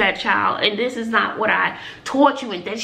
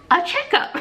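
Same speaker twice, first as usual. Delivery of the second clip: high-pitched, breaking into laughter; laughing; 12 semitones higher than usual. Real, not acted. Retelling the doctor's advice on checkups, laughing. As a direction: let the last word tip into a laugh.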